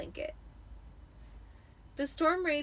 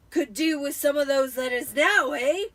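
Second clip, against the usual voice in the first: loud, higher jolly gloating; cheerful Uncle Vernon; 4 semitones higher, 10 dB louder than usual. A narrator's reading. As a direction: tease them, in a great mood. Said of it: "he said cheerfully".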